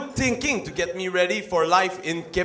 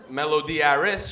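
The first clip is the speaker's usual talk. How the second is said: ordinary talk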